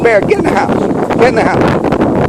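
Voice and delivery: high, loud firm command; ordering the dog. Real, not acted. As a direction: send the dog indoors now.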